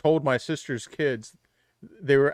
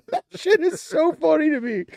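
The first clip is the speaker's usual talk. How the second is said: words broken up by high laughter; laughing; 7 dB louder than usual. Real, not acted. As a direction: laugh your way through the line. Cracking up at own story.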